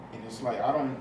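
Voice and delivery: ordinary delivery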